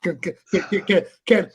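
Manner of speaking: high, stammering mock desperation building to a yell; hammed-up climax. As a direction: act overwhelmed, stuttering up to a loud cry. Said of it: "I be wishing that nut can last".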